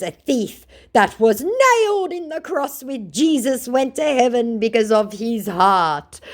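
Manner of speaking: high, slow put-on voice; mocking imitation of a preachy scammer; mock pious scammer. Voicing scammer's excuse.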